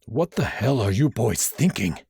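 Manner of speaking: a touch of an angry teacher's growl; growled scolding. Acted. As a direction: scold the boys sternly. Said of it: "Professor Warren growled".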